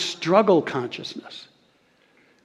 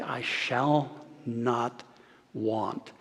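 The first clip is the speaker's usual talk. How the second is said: slow quiet proclamation; 4 semitones lower, 9 dB quieter, much slower than usual. Retold or imitated. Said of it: "he would say".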